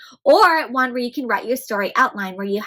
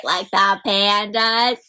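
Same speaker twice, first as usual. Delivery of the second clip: ordinary delivery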